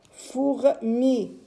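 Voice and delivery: "Fourmi" read as "Four... mi"; slow two-beat "Four-mi"; syllable by syllable. Shown as a demonstration. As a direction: separate "Four" from "mi". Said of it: "on va essayer de mettre les syllabes".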